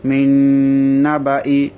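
slow "মিন নাবাই" with a long held n; silent-alif recitation. Shown as a demonstration. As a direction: recite "মিন নাবাই", humming the n, keeping baa short. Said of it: "আলিফটা উচ্চারণ হবে না".

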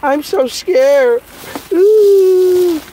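slow, high mocking squeal of fake fright; mock scared. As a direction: pretend to be terrified, mockingly. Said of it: "Like I supposed to have been like".

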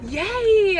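high, loud happy yay; excited cheer. Real, not acted. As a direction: cheer with delight. Cheering child after school.

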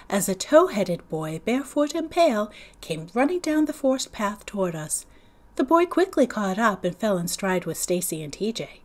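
plain reading voice

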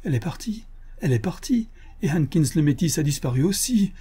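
high-pitched, bewildered lament; distraught. A narrator's reading. Husband finding his wife gone, distraught. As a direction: blurt it out, understated panic. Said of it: "Comme un fou".

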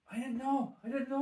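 4 king caught out, mildly hammed-up denial; 1 Abimelech pleading. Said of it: "And Abimelech's going".

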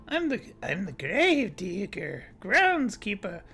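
put-on low drawling old man; grizzled groundskeeper voice; old guy voice. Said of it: "good old guy voice".

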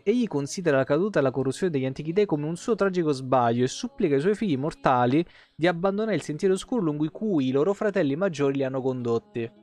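normal talking voice

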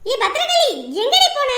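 high, loud call across the yard; shouting for her. Acted. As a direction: yell out for your sister, where has she gone. Sister calling, can't find her.

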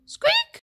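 sudden high squeaky peep; tiny squeak. Acted. Allosaur trying to roar, a tiny high squeak. Dinosaur tries to sing along.

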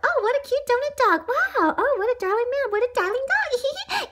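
high-pitched cooing passerby voice; gushing admirers. Acted. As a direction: gush sweetly in a high voice. Passersby gush over donut dog.

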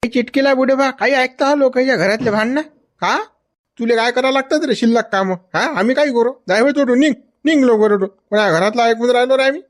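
high-pitched, irritated challenge; indignant quarrelling. Acted. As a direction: confront them and tell them off. Quarrel with people accused of eavesdropping.

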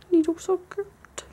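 small, high, drawn-out coo; high-pitched dog talk. Shown as a demonstration. Owner cooing to the cute dog, small high-pitched voice. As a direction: squeak it slowly to a dog. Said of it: "they do this really high-pitched".

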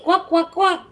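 high, loud playful quacking like a duck; duck quacks. Pretend. Imitating a duck for viewer Ducky.

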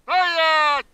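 sharp, very high stop command; commanding a dog. Real, not acted.

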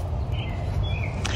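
sharp surprised breath in; a quick delighted gasp; gasping at the flower. Real, not acted. Spots the flower.

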